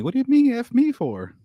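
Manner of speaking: [name]. Retold or imitated. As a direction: throw the rapper's outraged catchphrase. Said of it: "It's like fifty Cent".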